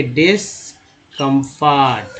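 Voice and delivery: "Discomfort" slowly split after the first part; slow sounding-out. Shown as a demonstration. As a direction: teach "discomfort" as slow, separate pieces. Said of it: "DS को डिस्ट". ("Discomfort" तोड़कर, धीमी गति से)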